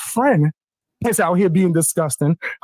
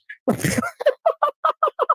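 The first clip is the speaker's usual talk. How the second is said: mocking laugh; 3 dB quieter than usual. Real, not acted. Taunting the other caller on stream.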